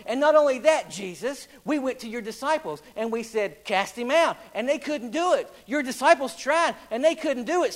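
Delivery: full-force revival preaching. Acted. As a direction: preach it out to the congregation. Preacher in full sermon delivery.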